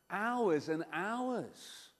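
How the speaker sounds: slow, drawn-out hours and hours; stretched out. Acted. Bored boy telling how long he waited, slow. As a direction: drag the words out to show the long wait.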